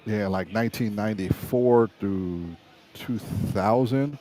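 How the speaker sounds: ordinary talk, nothing marked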